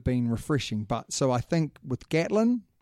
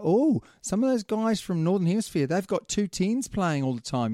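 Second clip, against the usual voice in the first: higher, lightly mocking tone; mock pundit voice; 6 semitones higher than usual. Mocking rugby bosses who copy the north, lightly hammed. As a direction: poke fun at the Northern Hemisphere copycats. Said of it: "And now it's like".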